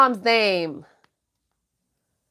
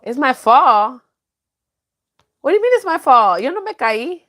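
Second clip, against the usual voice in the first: mock indignant; 3 semitones higher, 4 dB louder than usual. Real, not acted. Indignant reply to viewer comment.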